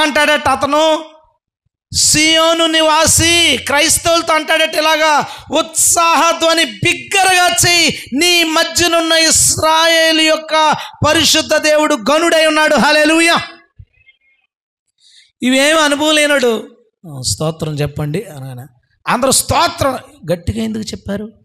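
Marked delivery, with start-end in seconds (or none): 0.0-13.4 full-force preaching. Real, not acted. Preacher proclaims scripture to Zion's people, high full-force preaching. 17.1-18.6 low, soft, offhand mumble; half-hearted call. Retold or imitated. Someone inexperienced asking for praise, half-hearted and low.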